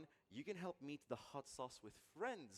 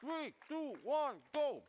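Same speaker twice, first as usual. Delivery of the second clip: higher, louder hype countdown; excited countdown; 12 semitones higher, 8 dB louder, slower than usual. Real, not acted. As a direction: count down to the big moment. Countdown before eating hot sauce.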